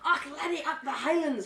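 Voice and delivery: hammed-up Highland Scottish accent through a laugh. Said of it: "kind of thing that everybody likes to do".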